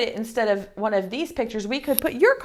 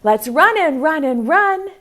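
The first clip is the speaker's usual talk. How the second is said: eager pumpkin; 7 semitones higher, 4 dB louder than usual. Acted. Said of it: "The fourth one said".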